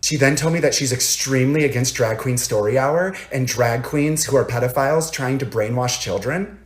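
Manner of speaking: ordinary talk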